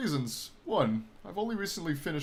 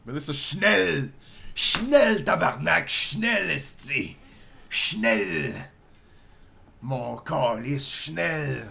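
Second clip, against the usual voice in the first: loud, hammed-up German pirate; hammed-up German accent; 4 dB louder, much slower than usual. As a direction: bark it like a mock German captain. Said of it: "German exchange student".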